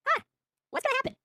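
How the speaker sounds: high-pitched baffled question, caught off guard; startled huh. Retold or imitated. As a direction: sheriff pulls into his driveway, mock-startled, high-pitched wonder. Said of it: "And I'm just sitting there like".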